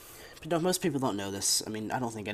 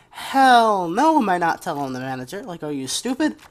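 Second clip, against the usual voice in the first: incredulous inner shout; 7 semitones higher, 12 dB louder than usual. Retold or imitated. Inner reaction to boss request.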